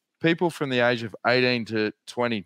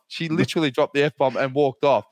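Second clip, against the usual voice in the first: ordinary talk, nothing marked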